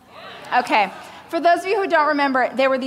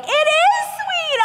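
very high, slow, gushing falsetto. Retold or imitated. Clueless author thanking publishers for calling her book sweet, very high and gushing. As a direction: squeal with naive delight.

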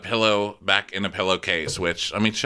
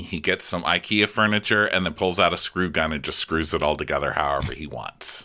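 ordinary talk

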